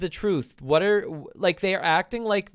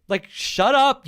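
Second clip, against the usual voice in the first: ordinary delivery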